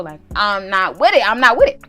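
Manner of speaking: protesting yell. Retold or imitated. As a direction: holler your refusal in mild mock panic. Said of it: "I gotta scream".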